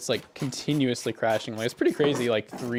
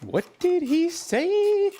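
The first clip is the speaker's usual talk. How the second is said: very high, loud, drawn-out squeal; squeaky mock question; 4 dB louder, much slower than usual. Streamer clowning over what was said, squeaky mock question. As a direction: ask it in a silly, sky-high voice.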